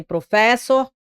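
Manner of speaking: professor said as pro-FÉ-ssor; wrong middle-syllable stress. Shown as a demonstration. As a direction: punch the fes in the middle of professor. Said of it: "nem professor".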